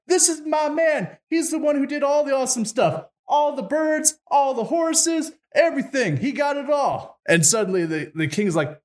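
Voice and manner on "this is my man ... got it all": much higher-pitched put-on lady's voice; excited bride. Lady tells the feast about Ivan, excited high voice. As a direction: gush proudly in a high mock female voice.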